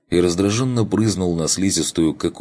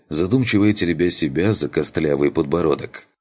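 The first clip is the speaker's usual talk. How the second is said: ordinary delivery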